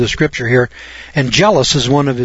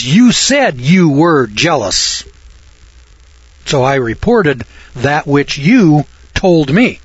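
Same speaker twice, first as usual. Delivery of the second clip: slow, deliberate emphasis on each word; careful self-defence; slower than usual. Acted. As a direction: spell it out slowly to the boss. Acted careful defence before God.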